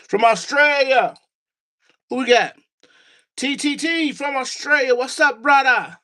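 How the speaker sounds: high, hearty greeting to a viewer; excited shout-out. Real, not acted. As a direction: hail them like a hyped-up host. Hailing a viewer from Australia.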